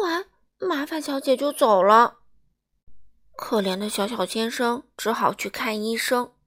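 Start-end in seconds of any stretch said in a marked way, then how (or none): none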